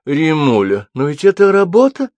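high, loud, hurt protest; stung, indignant objection; offended. A narrator's reading. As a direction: protest with wounded pride, climbing at the end. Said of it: "обиделась Инга".

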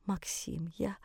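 faint, faltering whisper. Acted. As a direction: whisper it, voice failing you. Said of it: "прошептала". (шёпотом, еле слышно)

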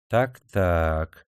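slushy sh for s; lisping. A narrator's reading. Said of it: "шепеляво, ласково сказал Шишковский".

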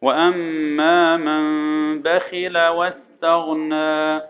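very slow, measured chant; unhurried tajweed model for students; Quran recitation. Shown as a demonstration. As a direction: intone the verse with long held nasals. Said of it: "الاحكام هنا".

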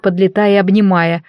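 usual speaking voice

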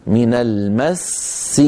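من المس read slowly, running on past the double seen; continuous reading with both seens. Shown as a demonstration. Teacher shows students the two seens in المس, joined reading. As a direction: hold the doubled seen of المس, then release it into a short i. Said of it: "فيها سينان".

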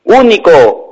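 high, loud exultant call; ringing forceful cry; father's shout. Acted. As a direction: call it out big into the tunnel.

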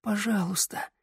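soft, high, weak plea; barely breathed out. A narrator's reading. Sanda begs her captor for mercy, barely breathed. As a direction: plead with hardly any breath. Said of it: "еле выдохнула Санда".

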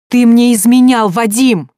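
high, loud cry of accusation; shouted accusation. A narrator's reading. Sonya accusing her husband of cheating, loud shout. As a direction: hurl it at him. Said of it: "выкрикнула Соня".

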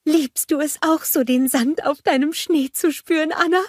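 high, joyful cry of a snowman; exulting. A narrator's reading. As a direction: Olaf crowing with delight. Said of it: "jauchzte er".

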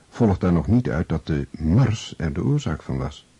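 normal talking voice

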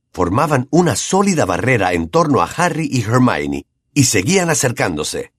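plain reading voice

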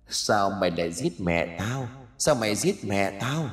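soft, hollow haunting voice; haunted child's voice accusing the mother, soft and eerie; eerie ghost child. Acted. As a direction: let it drift out like a vengeful spirit. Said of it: "Giọng nói âm vang, thanh âm lanh lành".

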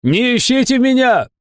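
very high, strained holler; calling out. A narrator's reading. Fat man leaving into the forest, calling out. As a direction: call back over a distance.

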